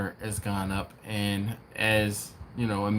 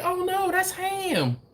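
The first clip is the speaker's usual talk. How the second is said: high, drawn-out waitress imitation; nonchalant waitress; slower than usual. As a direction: mimic her breezy reply, slow and way up high. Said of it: "it was so nonchalant".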